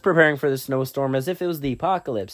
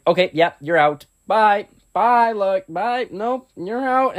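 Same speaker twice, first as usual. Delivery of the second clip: loud, rising teacher voice; louder and higher, shooing someone out; teacher kicking out; 5 semitones higher, 4 dB louder than usual. Retold or imitated. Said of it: "He was like".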